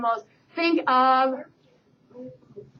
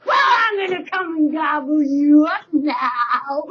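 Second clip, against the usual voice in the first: troll roar; 3 semitones higher, slower than usual. Acted. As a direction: growl the threat slowly. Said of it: "said the troll".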